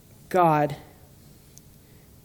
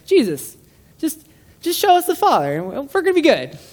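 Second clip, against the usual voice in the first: much higher, pleading mock disciple voice; eager disciple; 10 semitones higher, 5 dB louder than usual. Philip asking Jesus to show the Father, high mock pleading. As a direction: play the keen disciple begging sweetly. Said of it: "put it in the form of a question".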